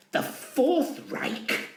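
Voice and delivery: slow, whispered disbelief; incredulous whisper. Acted. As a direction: breathe it out in disbelief. Stunned aside.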